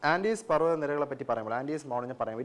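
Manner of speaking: ordinary delivery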